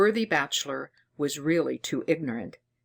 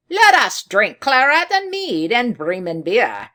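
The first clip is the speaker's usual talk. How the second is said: loud, high, slow drinking shout; 9 semitones higher, 11 dB louder than usual. A narrator's reading. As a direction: bellow out the toast. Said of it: "shouted one of the guests".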